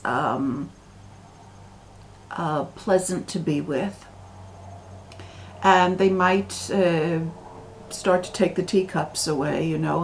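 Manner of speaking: usual speaking voice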